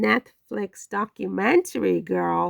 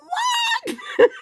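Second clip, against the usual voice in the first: shrieking laugh. Real, not acted. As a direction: burst into a squealing laugh. Laughing at the absurdity.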